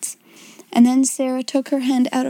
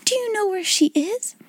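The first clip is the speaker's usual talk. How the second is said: much higher, softer and hopeful; eager question; 9 semitones higher, 4 dB quieter than usual. A narrator's reading. Sarah pressing the bun woman for news, much higher and eager. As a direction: ask it breathless with hope. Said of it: "exclaimed Sarah".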